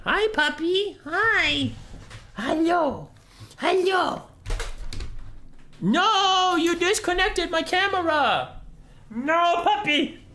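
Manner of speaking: very high sing-song puppy voice. Real, not acted. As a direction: coo at the dog in a squeaky voice. Talking to puppy.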